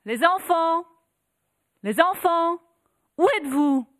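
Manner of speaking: high, loud desperate call; mother calling out. Acted. Mother searching the house for her children, calling loudly. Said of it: "et cria".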